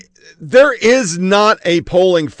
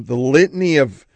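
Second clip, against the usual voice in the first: ordinary delivery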